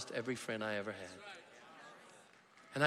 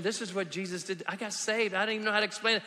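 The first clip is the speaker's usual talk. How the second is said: ordinary talk, nothing marked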